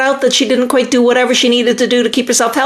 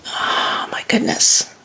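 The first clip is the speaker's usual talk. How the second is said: whispered gasp; 6 dB quieter, slower than usual. Real, not acted. Reacting to a viewer's comment.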